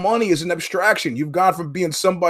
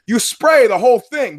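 higher and louder, hammering the point; exasperated insisting; 6 semitones higher, 5 dB louder than usual. Retold or imitated. Exasperated customer hammering point to service guy, louder. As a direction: lose patience with him.

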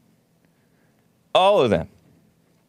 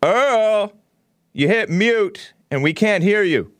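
calling out; 8 semitones higher, slower than usual. Real, not acted. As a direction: call out to the caller. Calling to caller on mute.

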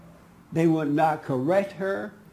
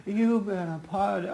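mock feeble demand, slightly raised pitch; weak, pathetic husband; 5 semitones higher than usual. Cowed husband demands an apology, weak pathetic voice. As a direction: imitate an ineffectual order. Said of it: "it was so weak and so pathetic".

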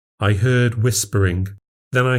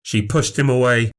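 ordinary delivery

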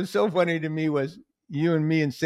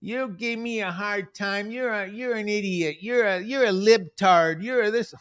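loud mock rant, pitch pushed higher; hammed-up rant at the comic; imitating Roseanne ranting; 5 semitones higher, 4 dB louder than usual. Roseanne rants at the comic online, in a loud mock rant. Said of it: "Roseanne just goes".

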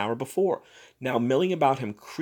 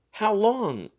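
sharp high demand; alarmed question; 9 semitones higher than usual. Acted. Ladies to Thurkell, alarmed. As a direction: catch him out.

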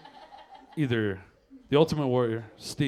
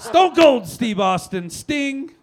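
hype announcer; 11 semitones higher, 9 dB louder, much slower than usual. Acted. Hyping wrestler names.

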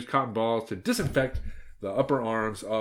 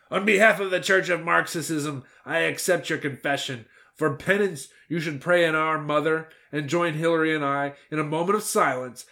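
a lightly raised, solemn priest voice; priestly girl boss; 6 semitones higher, 4 dB louder than usual. Acted. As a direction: absolve her like a self-assured confessor.

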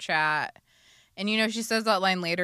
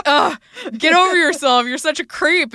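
loud, higher mocking put-down; Xander sneering; 8 semitones higher, 11 dB louder than usual. Xander sneering at Spike, loud mocking scoff. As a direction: scoff him off like he's beneath you. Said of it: "Xander's like".

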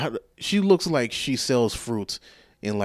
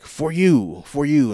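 lightly voiced pushy vendor, higher and louder; imitated street vendor; 5 semitones higher, 5 dB louder, much slower than usual. Vendor giving tourist a bracelet, imitated sales pitch. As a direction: mimic a hawker pressing a gift on someone.

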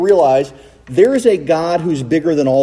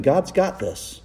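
hushed God's got this; soft reassuring refrain; 6 dB quieter, slower than usual. Acted. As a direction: say it softly like a comfort.

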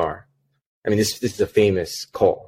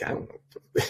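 whispered; 9 dB quieter, slower than usual. Retold or imitated. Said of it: "I'm like".

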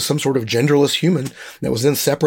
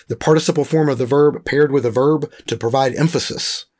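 usual speaking voice

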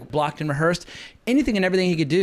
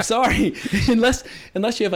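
laughing; 7 semitones higher than usual. Real, not acted. Laughing apology mid-rant.

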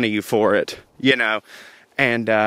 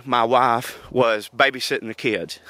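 ordinary talk, nothing marked

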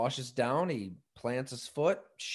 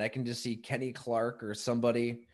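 ordinary delivery

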